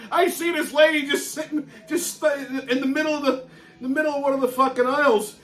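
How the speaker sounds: ordinary talk, nothing marked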